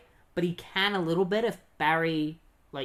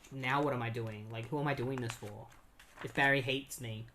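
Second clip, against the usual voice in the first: lower, softer mock despair; dejected; 3 semitones lower, 6 dB quieter than usual. Wally collapsed on the ground after escaping, dejected. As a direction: ask yourself quietly, drained.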